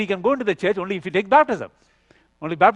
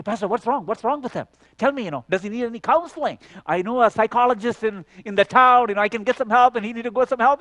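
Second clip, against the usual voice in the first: higher-pitched, worried questioning; retold concern; 7 semitones higher, 3 dB louder than usual. Caller asking the pastor about the boy, retold fatherly concern. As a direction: press for answers like a concerned father. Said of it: "I'm just really concerned, like a father".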